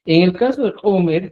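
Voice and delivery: ordinary talk, nothing marked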